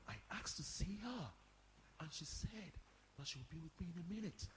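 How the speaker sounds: soft, hushed conspiratorial reply; whispering. Acted. Caretakers conferring outside the office door, whispering. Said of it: "Why are you whispering?".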